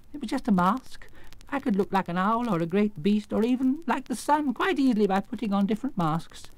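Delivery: high-pitched, apologetic explainer; meek little man. Acted. Exposed wizard explaining his masks, meek. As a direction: explain away the tricks in a high voice.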